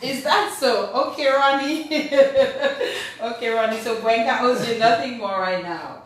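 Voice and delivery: laughing mock surprise, playfully loud; amused teasing. Real, not acted. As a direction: tease Ronnie loudly through a laugh. Teasing banter over a debt.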